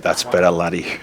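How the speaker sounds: mock Scottish accent for a punchline; thick Scottish accent. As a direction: imitate an old mate's Scottish accent. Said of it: "in his thick Scottish accent".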